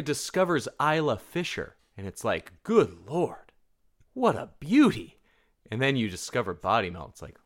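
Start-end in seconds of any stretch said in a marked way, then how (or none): 2.6-5.2 hammed-up swoon, rising higher and louder; gasp-worthy beauty, loudly admired in pretend awe; mock admiration. America discovering Isla Fisher, mock admiration.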